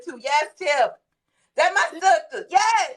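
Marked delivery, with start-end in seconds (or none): none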